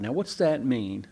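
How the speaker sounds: ordinary delivery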